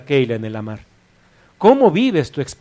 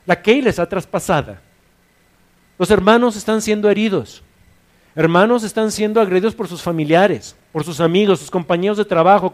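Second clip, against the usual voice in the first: higher, louder urgent sermon; fiery preaching; 5 semitones higher, 4 dB louder than usual. Acted. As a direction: rouse the congregation. Preacher urges at full force.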